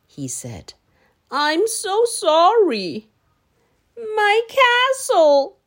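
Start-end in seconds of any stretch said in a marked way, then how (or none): 1.3-3.1 higher, slow, apologetic dinosaur voice; sorry dinosaur. Acted. Dinosaur apologizing to Danny for the castle, slow and sorry. 3.9-5.6 high, slow, dismayed cry; cried. Acted. Danny crying over his flattened castle, high and slow. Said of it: "cried Danny".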